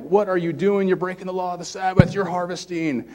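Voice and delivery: high-pitched imitation of an accuser; accusing Pharisee. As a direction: lightly act out a scandalized rule-keeper. Pharisees acted accusing the disciples.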